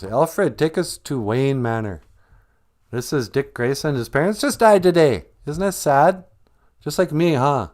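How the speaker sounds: high, loud, oblivious rich guy; blithe Bruce Wayne. Acted. Bruce Wayne tells butler Alfred about the orphaned boy, blithely high and loud.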